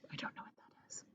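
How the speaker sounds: unvoiced whisper aside. Real, not acted. Reader confessing to the children, whispered. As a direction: drop to a whisper for yourself. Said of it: "aside whispered".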